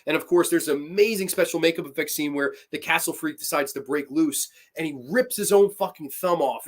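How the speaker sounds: ordinary talk, nothing marked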